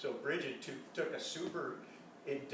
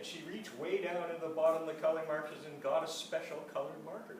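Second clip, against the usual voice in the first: usual speaking voice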